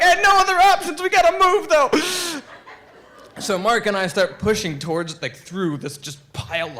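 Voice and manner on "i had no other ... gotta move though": high, loud, quick mock panic; urgent retort. Storyteller answering his hurt friend in the river, urgent yell. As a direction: holler back fast and high.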